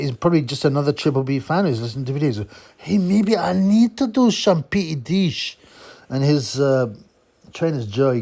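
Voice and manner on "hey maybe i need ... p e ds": high, slow hammed-up Swedish accent; mock Swedish accent. Boxer wondering about taking PEDs, mock Swedish accent. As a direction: muse slowly in a high, slushy Swedish accent. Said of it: "a Swedish accent".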